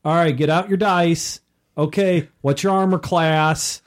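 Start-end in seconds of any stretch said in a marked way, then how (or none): none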